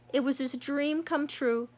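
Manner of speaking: ordinary delivery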